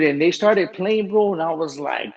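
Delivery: ordinary talk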